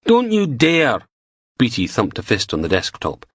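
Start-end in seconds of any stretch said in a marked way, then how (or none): 0.1-1.1 slow, higher, louder outrage; furious. Acted. DI furious at Logan in the office. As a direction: spit it out, offended.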